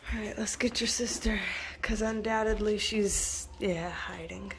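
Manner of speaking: voiceless hush while creeping up; whisper. Real, not acted. Owner whispering while going to fetch the scared dog. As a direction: whisper so the hiding dog won't run.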